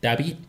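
"David" with a soft B, dabid; Spanish David. Shown as a demonstration. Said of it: "they say the B like a".

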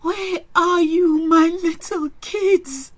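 high calling voice; worried mother. A narrator's reading. Gogo calls for her missing kids. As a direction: call out anxiously.

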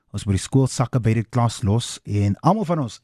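ordinary talk, nothing marked

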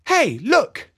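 put-on high, excited little boy; a boy spotting eyes in the dark; child calling out. Jeremy spots the cat.

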